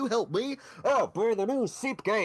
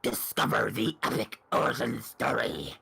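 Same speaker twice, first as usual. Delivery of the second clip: trailer guy voice; 12 semitones lower than usual. Acted. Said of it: "can you do the trailer guy voice".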